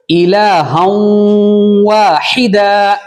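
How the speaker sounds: slow recited واحدا with long vowels; tajweed recitation. Shown as a demonstration. Teacher recites for the class, slow chanted tajweed. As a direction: chant الہا واحدا drawn out, nasal ghunna. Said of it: "وہ دیکھو".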